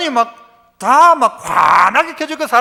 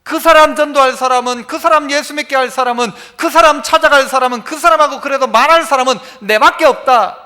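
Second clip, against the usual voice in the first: louder, building preacher's cry; fervent preaching; 3 semitones higher, 5 dB louder than usual. Acted. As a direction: preach it with rising conviction. Preaching at full force. (힘차게 외치는 설교)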